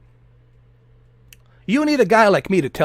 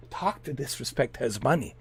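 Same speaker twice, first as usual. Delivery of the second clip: the same line dropped much softer, almost to himself; hushed aside; 6 semitones lower, 15 dB quieter than usual. Real, not acted. Repeating to viewers that the streamer is rich, softly. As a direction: let it fall away to a murmur.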